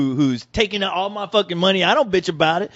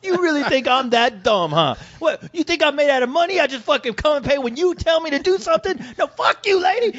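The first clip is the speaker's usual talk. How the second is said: higher, indignant rant; mock tirade; 6 semitones higher than usual. Husband ranting at his wife about the bill, mock indignant and higher. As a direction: rant back at her, offended.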